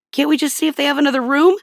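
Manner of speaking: fast, high whiny plea; whiny husband. Retold or imitated. Husband pleads with wife for another room, fast and whiny. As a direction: rush out a hopeful whine.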